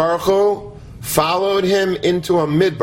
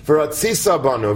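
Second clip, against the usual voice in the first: ordinary delivery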